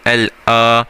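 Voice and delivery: ordinary talk, nothing marked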